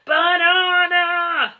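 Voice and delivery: slow, booming, high-strained roar; gorilla roar. A narrator's reading. Gorilla demanding bananas, a stretched booming roar. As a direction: bellow the word like a giant ape. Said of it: "roared the giant gorilla".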